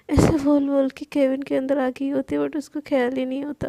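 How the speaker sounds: usual speaking voice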